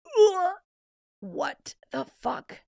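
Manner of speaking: recoil, then stretch it out slowly; disgusted disbelief. Retold or imitated. Reacting to photos of worms, disgusted disbelief.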